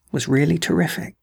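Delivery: usual speaking voice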